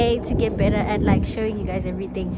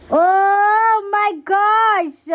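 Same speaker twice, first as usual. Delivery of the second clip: high, drawn-out oh my gosh in dismay; a long wail of disbelief; dismayed cry; 9 semitones higher, 5 dB louder, much slower than usual. Real, not acted. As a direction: stretch it out in horror at the mistake. Bought the wrong thing.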